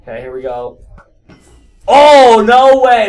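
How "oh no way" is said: loud, higher disbelieving outburst; excited shout. Real, not acted. Gamer after a surprise kill, loud excited shout. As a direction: yell it, thrilled at the kill.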